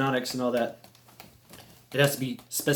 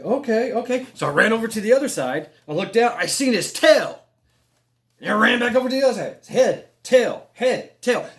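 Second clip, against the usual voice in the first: high, loud, rushing retelling; breathless excitement; 7 semitones higher, 5 dB louder than usual. Retold or imitated. Running back and forth over the bridge, high loud excitement. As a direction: build it up, punching head and tail.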